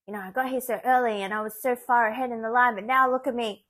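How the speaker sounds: hammed-up irritated grumble; mock annoyed complaint. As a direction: play the impatient old patient who lost her place. Retelling annoyed old patients.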